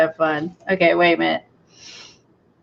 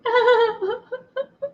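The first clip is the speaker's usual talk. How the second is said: high-pitched giggle; delighted giggle; 3 dB quieter than usual. Real, not acted. Crafter tickled by her pond idea, delighted giggle.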